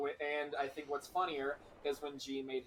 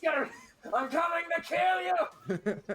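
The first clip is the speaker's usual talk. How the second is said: Sam Hyde impression; 7 dB louder than usual. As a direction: scream it high, laughing. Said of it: "like Sam Hyde".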